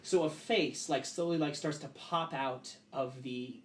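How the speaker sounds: ordinary talk, nothing marked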